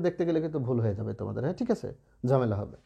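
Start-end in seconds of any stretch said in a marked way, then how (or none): none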